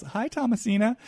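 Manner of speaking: a sugary "Hi, Thomasina" rising at the end; Kathy's greeting. Acted. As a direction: play it innocent and sweet. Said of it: "drop into Kathy towards Sutton as she says".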